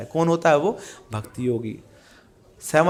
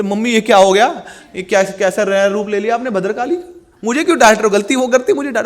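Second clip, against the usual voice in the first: protesting daughter; 4 semitones higher, 6 dB louder than usual. Acted. Daughter protests to scolding mother.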